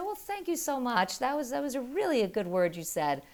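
reward the apology sweetly; sweet praise. Retold or imitated. Mother thanking daughter for apology, high and sweet. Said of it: "And I said".

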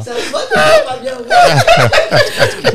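words squeezed out through high laughter; laughing. Real, not acted. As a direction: laugh while you say it. Laughing hard at the anecdote. (en riant aux éclats)

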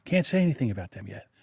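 dropped low and soft, conspiratorial; hushed. Real, not acted. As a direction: keep it hushed like a secret.

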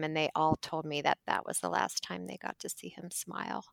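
soft, sober remembrance; subdued, sad. Real, not acted. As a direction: tell of a loss gently and quietly. Telling of his death from cancer.

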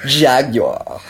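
copying the British jaguar, R dropped; English accent. Retold or imitated. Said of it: "jaguar in the English accent".

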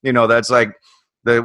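ordinary talk, nothing marked

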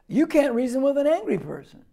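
ordinary delivery

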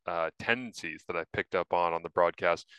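normal talking voice

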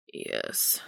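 low whispered growl. Acted. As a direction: growl the word softly. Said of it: "sounding more like a growl".

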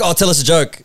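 high-pitched pushy demand; shouting stranger. Retold or imitated. Stranger hears he does comedy, shouty demand. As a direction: imitate a stranger shouting a request. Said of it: "she was just shouting at us".

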